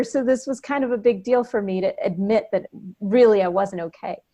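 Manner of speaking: usual speaking voice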